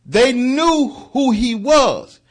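high, slow, loud preaching stretch; fervent preaching cry. Acted. Preacher driving home that demons knew Christ, slow loud cry. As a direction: draw out every word at full force.

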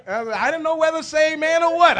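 very high, loud exclamation; shouted punchline. Retold or imitated. Shouted repeat of punchline to congregation.

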